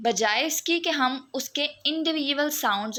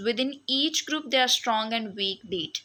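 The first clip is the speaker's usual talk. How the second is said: slow dictation read; much slower than usual. Acted. Slow reading of textbook quote.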